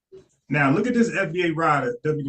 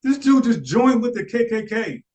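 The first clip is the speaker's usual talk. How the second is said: high, louder mock gossip about GARVEY; mock hyped friend; 7 semitones higher, 3 dB louder than usual. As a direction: play an excited guy spreading news, pitched up high. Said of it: "He like".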